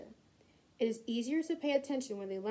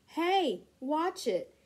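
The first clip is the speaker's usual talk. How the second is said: high, loud shout at someone; raised, higher-pitched cry to look out; yelled warning; 6 semitones higher, 6 dB louder, much slower than usual. A narrator's reading. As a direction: yell out a sharp warning. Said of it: "Nancy yells".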